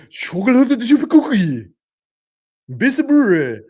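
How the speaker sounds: loud, high fake-Japanese accent; hammed-up imitation Japanese; mock Japanese. Shown as a demonstration. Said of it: "I can say one word in Japanese".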